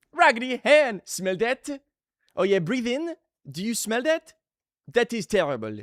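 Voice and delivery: imitated French clown teacher, rising questions; mock critique in a French accent. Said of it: "but they'd be like".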